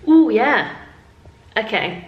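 usual speaking voice

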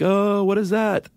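high, drawn-out mock scream; joke scream. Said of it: "kinda joke screaming".

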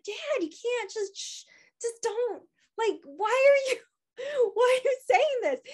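high, hushed plea rising into disbelief; pleading protest. Retold or imitated. Daughter shushing her dad at hospital, pleading protest.

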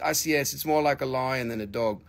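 usual speaking voice

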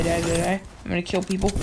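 normal talking voice

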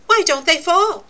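ordinary talk

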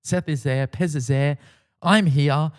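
ordinary talk, nothing marked

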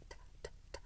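트 as just a whispered puff of air, no vowel; a bare t release; voiceless t burst. Shown as a demonstration. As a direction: release the t with only breath. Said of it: "파열되는 소리만".

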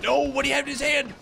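high, fast startled outburst; alarmed yell. Real, not acted. Gamer reacting to what an enemy holds, alarmed. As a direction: yelp it in a high, alarmed rush.

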